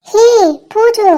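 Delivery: high sing-song call of the nickname பூஜு. Acted. Friend calling Pooja by her pet name, high and bright. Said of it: "பேரை பாரு, பூஜு, கூஜுன்னுகிட்டு".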